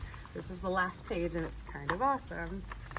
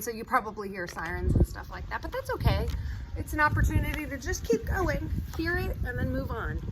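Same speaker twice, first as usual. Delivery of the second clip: ordinary delivery, nothing marked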